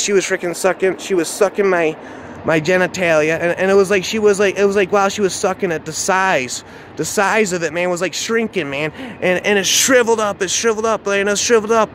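ordinary delivery, nothing marked

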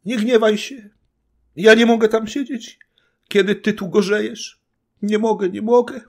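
very high, loud wailing woman's voice; sobbing plea. A narrator's reading. Frightened wife begs her angry husband's forgiveness, sobbing call. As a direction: plead tearfully in short cries. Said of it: "poczęła wołać łkającym głosem".

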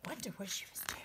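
soft whispered aside. Real, not acted. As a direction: whisper a question. Secretive aside to child.

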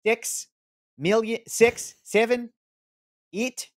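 put-on high, strained New Zealand accent counting; fail the New Zealand accent; bad New Zealand accent. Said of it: "The eight was pretty bad".

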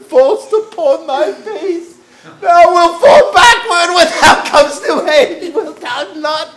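high, loud jesting husband; merry husband. Acted. Nurse repeating her husband's joke to her mistress, high, loud and laughing. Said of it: "quoth my husband".

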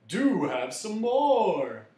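loud, high, drawn-out offer; insistent offer. A narrator's reading. Magician suggests another helping, loudly insistent. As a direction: press it on them in a big slow voice. Host urging guests to eat.

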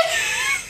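high squealing laugh; helpless high-pitched laughter; shrieking laugh. Real, not acted. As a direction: burst into a shrill cackle. Cracking up.

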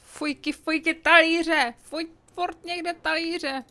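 high, loud fuj and talíře with slow drawn-out groaning; disgusted complaint. Real, not acted. Gamer groans at dirty plates all over the house. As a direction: moan in disgust at the mess.

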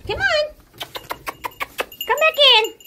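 high loud call to the goats. Real, not acted. Calling goats loudly.

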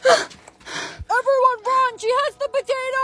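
high, drawn-out cry of alarm; panicked warning. Acted. Player warning everyone about the potato, high panicked cry. Warning others in a deadly game.

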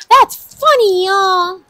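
loud, high-pitched whoop to the crowd; excited exclamation. Real, not acted. Host tells viewers the matching totals are funny, excited exclamation.

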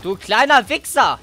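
real fired-up gamer shouting; angry triumphant shout. Real, not acted. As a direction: yell at the enemy in triumph.

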